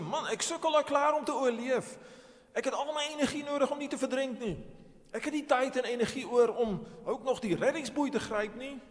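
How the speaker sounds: high, loud acted protest; protesterende terugroep. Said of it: "Gaan jy dan terugroep en sê". (hard en hoog teëgepraat)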